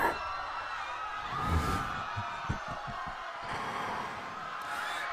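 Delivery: high unhinged demon cackle; evil laugh. Acted. Demon celebrates siding with the victor, high evil cackle. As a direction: cackle like you already won.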